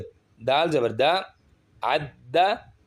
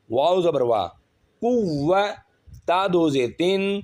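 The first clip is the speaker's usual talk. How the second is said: normal talking voice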